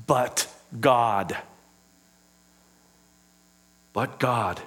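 low, soft, reverent sermon voice; softly preached gospel truth; hushed preaching. Acted. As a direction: draw them in quietly. Quiet reverent preaching passage.

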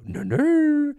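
very high, loud chanted yell; mock war cry. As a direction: shriek the nonsense reply like a battle cry. Grimace's war cry answering bystander.

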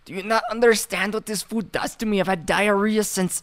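a bad Nacho Libre-style Mexican voice.

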